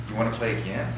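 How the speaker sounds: soft, tentative mock question; meekly testing the waters, played for laughs; timid offer. Host to friend who threw the controller, timid and soft. Host cautious after guest's tantrum.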